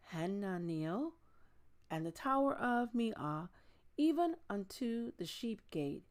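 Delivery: steady, measured Bible reading voice; scripture reading. Acted. Reading Nehemiah aloud to online viewers, measured scripture reading. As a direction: read each name and verse carefully and clearly.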